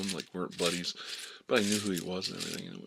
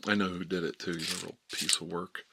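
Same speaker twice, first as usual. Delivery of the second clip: usual speaking voice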